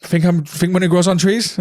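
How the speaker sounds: put-on teenage sarcastic retort to a salesman; mock sarcasm. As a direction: needle the salesman, slowly.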